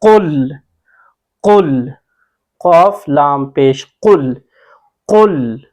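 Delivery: full, firm Qaf on each कुल; heavy Qaf. Shown as a demonstration. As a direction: spell and drill कुल slowly from the back of the throat. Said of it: "कॉफ को हमने किस तरह पढ़ना".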